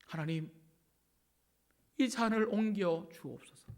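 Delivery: soft, halting plea to God; understated, weary praying of Jesus; burdened prayer. Acted. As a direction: pray quietly with a long pause after the address. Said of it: "그 힘든 마음을 토로한".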